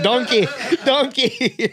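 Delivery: high, mock Scottish ogre voice; Shrek. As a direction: call out like a Scottish cartoon ogre.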